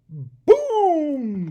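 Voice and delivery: loud, drawn-out high boom; blast-off boom. A narrator's reading. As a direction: rocket exploding into flight. Machine blasts off.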